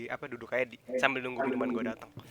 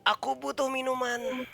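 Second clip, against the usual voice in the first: put-on tipsy drunk; 11 semitones higher, 3 dB louder than usual. Character demanding more ale.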